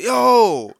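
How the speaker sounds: high, loud "Yo!" of alarm; retold startled shout. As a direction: call out like a friend taken aback. Friend reacts to bleeding face.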